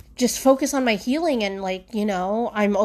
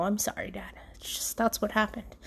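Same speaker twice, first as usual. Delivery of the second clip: unvoiced whisper to the ashes; soft whispered sorry to Dad; whispered apology; 9 dB quieter than usual. Real, not acted. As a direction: hush it like a private apology. Apologizing to her dead dad's ashes.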